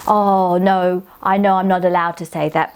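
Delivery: ordinary delivery, nothing marked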